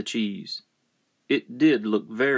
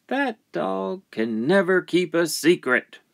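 a hint of a higher character voice; lightly voiced complaint, pitched up; animal character's complaint; 8 semitones higher than usual. Animal grumbles about the dog.

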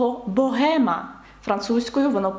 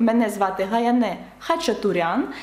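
ordinary delivery

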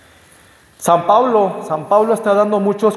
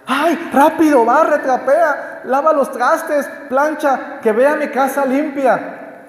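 high, hurried mock panic of a housewife cleaning; frantic housewife; 8 semitones higher, slower than usual. Housewife hurrying family before the priest arrives, high mock panic. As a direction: play the flustered hostess barking chores, pitched up.

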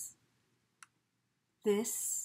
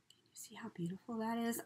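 quick hushed whisper; 8 dB quieter, faster than usual. Real, not acted. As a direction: whisper your amazement. Admiring a piece softly.

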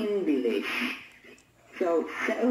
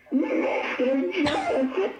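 high, tearful, laughing whine; a hammed-up crying girl whines that she will report her teacher; whiny schoolgirl threat; 5 semitones higher than usual. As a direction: threaten to tell the principal like a crying little girl. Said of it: "you whiny little bitch".

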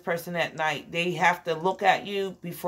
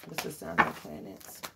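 whispering; 9 dB quieter than usual. Real, not acted. Unvoiced repeat of question.

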